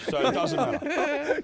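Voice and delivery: high, loud, slow, through laughter; can't keep a straight face; cracking up mid-line; laughing. Real, not acted. Laughing defence.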